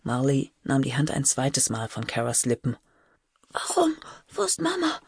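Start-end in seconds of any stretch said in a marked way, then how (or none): 3.5-5.0 high, small whispered questions; breathless whisper. A narrator's reading. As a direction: whisper like an anxious little girl, breathless. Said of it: "Wisperte Cara atemlos". (atemloses, hohes Wispern)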